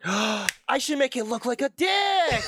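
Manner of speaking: slow, high excited exclamation; excited shout. Retold or imitated. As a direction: blurt it out like a eureka moment. Sudden idea acted out.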